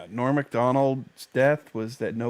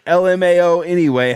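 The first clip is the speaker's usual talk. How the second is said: loud; 6 semitones higher, 9 dB louder than usual. Pretend. Reading the tweet aloud.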